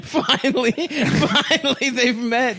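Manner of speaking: high laughing delivery. Real, not acted. Laughing at the bit.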